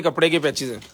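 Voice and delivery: ordinary delivery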